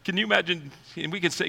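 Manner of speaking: ordinary talk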